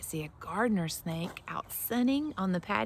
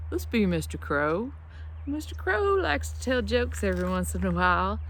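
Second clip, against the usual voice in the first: ordinary talk, nothing marked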